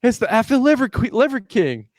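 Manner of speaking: high-pitched exasperated outburst; mock indignant wife. Wife admitting she got fooled, high indignant cry. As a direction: squeal it in disbelief at being duped.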